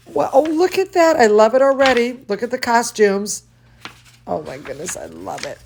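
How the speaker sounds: usual speaking voice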